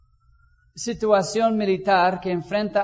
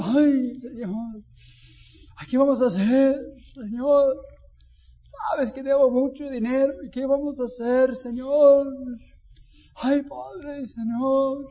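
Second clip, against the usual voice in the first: anxious, higher, whining prayer; worried believer praying; 3 semitones higher than usual. Pretend. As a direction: fret to God like a debtor in a panic. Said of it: "Y andamos así".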